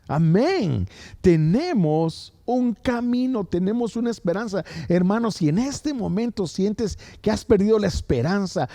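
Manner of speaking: full-force pulpit preaching; fiery preaching. Acted. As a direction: preach it out to the whole church.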